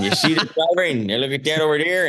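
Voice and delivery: imitated Chicago accent; Chicago uncle accent. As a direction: show it off in a South Side accent. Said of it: "we had to come over".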